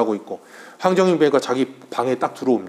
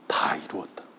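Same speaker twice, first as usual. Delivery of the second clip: much lower, whispered last breath; dying whisper; 11 semitones lower, 11 dB quieter than usual. Retold or imitated. Jesus on the cross, whispering his last words.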